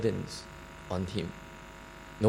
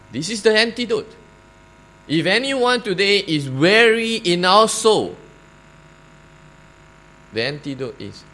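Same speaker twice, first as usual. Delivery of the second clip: preaching; 4 semitones higher, 5 dB louder than usual. Acted. Altar call to the weary.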